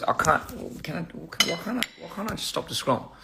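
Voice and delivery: soft, breathy quoted plea; quiet, pleading comment voice; hushed quote. Retold or imitated. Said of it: "somebody says".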